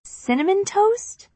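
high, slow disbelieving question; can't believe what she heard; incredulous. A narrator's reading. Incredulous repeat of answer.

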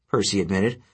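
usual speaking voice

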